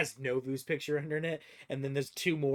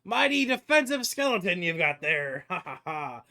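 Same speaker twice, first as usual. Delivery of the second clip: mocking belly laugh, raised in pitch; [name] laughing hard; 4 semitones higher, 5 dB louder than usual. Dwarven stranger laughs at the party's defensive skeleton, mocking laughter. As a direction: laugh it out loudly in character, easing off at the end. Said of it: "[name]'s laughing his ass off".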